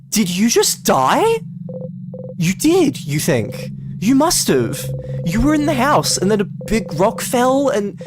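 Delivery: frantic inner voice spiralling; rising panic. Acted. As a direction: realize you just died and panic. Panicked inner voice realizing death.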